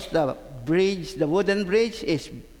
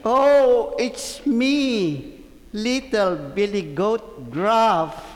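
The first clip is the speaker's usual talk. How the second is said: raised voice of a small storybook goat; little billy goat; slower than usual. Acted. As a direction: answer the troll as a little goat. Little goat answers the troll.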